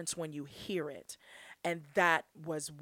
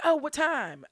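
high, loud, keen question; mock eager reply; 8 semitones higher, 6 dB louder than usual. Answering cousin's invitation to go out, mock eager. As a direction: pipe up as if tempted by the invitation.

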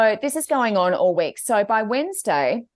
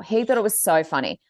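usual speaking voice

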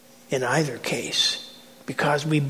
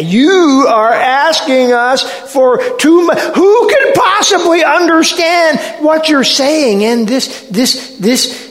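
high, loud, sputtering protest; indignant crowd member crying out; outraged complaint; 10 semitones higher, 8 dB louder than usual. Acted. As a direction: shout in disbelief, then stumble over your words. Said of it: "and the complaining".

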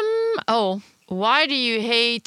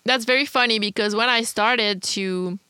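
normal talking voice

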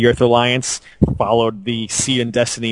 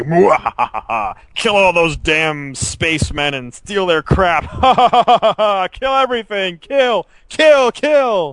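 slow, hammy cackling villain; slow theatrical villain menace; 8 semitones higher, slower than usual. Retold or imitated. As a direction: ham it up like a cartoon villain. Villain voice mocking evil Alliance.

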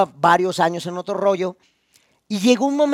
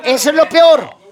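emphatic conclusion; 7 semitones higher, 8 dB louder than usual. Real, not acted. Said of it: "No, bueno".

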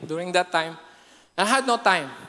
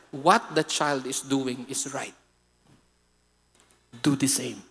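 God's voice; 8 semitones lower, 6 dB quieter than usual. Retold or imitated. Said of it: "And God said".

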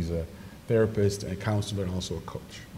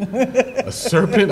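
mock astonishment; 12 semitones higher, much slower than usual. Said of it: "my mind thinks theologically".

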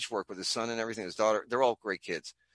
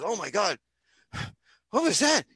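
shocked woman; 7 semitones higher than usual. Retold or imitated. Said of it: "she starts choking. She goes".

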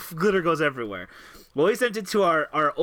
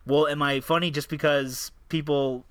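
ordinary talk

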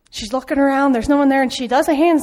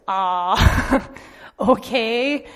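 a startled little laugh into okay; much slower than usual. Retold or imitated. Girl hearing God's odd request, laughing nervously. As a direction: laugh it off, half unsure. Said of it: "And she goes".

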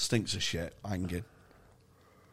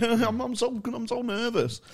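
mock nerdy student; 12 semitones higher than usual. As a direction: send up a shy maths geek who can't talk to girls. Nerd impression continues, laughing.

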